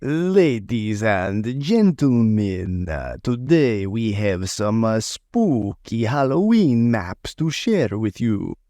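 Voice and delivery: slow, theatrical mad scientist voice. Pretend. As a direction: announce it like a spooky villain. Spooky villain intro before plain greeting.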